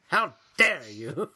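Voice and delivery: slow, indignant "how dare you"; mock outrage. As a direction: imitate an offended friend scolding. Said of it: "he is mad".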